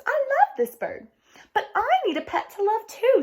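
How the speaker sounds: put-on high, pleading little girl; high girlish voice. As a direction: plead sweetly like a child. Girls' quoted exchange.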